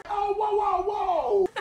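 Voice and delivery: startled, pitch shooting high; high startled whoa. Real, not acted. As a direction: yelp of alarm at the attack. Food fight, dodging.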